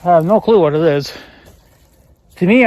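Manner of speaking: normal talking voice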